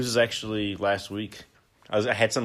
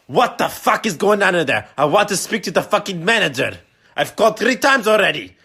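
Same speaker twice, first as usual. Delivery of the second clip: yelled much higher and louder, full fury; furious shout; 9 semitones higher, 9 dB louder than usual. Retold or imitated. Dad losing it on the phone with the store, furious yelling. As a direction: bellow down the phone at the clerk.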